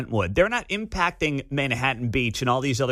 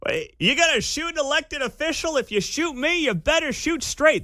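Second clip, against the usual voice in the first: high-pitched mocking mimicry; exaggerated high parody voice; mock impression of politician; 14 semitones higher than usual. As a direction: squeal it back in mockery, pitched way up. Mimics the played clip mockingly.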